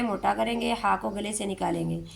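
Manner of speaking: usual speaking voice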